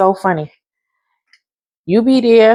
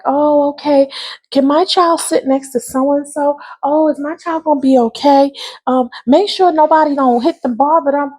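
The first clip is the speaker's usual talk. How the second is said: high, anxious parent voice; worried mom; 7 semitones higher than usual. Retold or imitated. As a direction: mimic the fretting mother, much higher. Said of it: "your mother here like".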